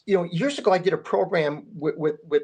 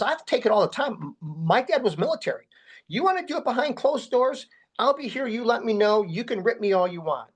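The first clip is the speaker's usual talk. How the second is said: mild retold outburst, pitched much higher; retold yelling; 7 semitones higher than usual. Starr standing up to Coach Lombardi, heated raised voice. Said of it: "yelling and screaming".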